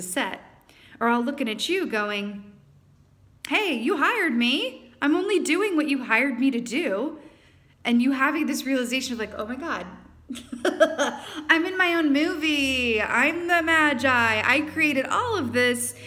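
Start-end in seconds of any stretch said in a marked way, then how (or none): 3.5-7.2 mock-protest, you hired me; stagehand protesting. Stagehands answer the creator. 9.4-15.9 laughing, higher and louder amazement; laughing realization. Retold or imitated.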